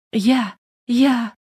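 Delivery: high, broken sobbing stutter; sobbing stammer. Acted. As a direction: choke out each word through sobs. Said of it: "всхлипывала Наташа".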